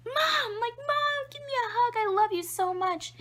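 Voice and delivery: put-on very high, loud squealing toddler; overjoyed baby. Happiest baby in the world hugging mom, overjoyed. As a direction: imitate a baby gleefully begging for affection. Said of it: "the happiest baby in the world".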